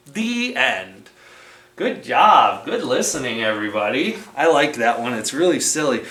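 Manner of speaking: usual speaking voice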